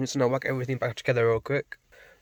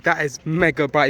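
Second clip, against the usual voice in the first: ordinary talk, nothing marked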